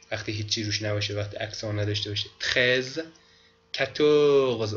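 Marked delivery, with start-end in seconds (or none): 3.7-4.7 کتورز said slowly, the final sound dropped; slow French number. Shown as a demonstration. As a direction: stretch out کتورز for the students. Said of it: "او یا آخر خونده نمیشه". (کتورز را آهسته و بدون حرف آخر بگو)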